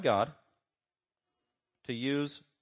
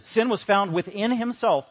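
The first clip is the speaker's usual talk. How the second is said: emphatic quote; 7 semitones higher, 6 dB louder than usual. Shown as a demonstration.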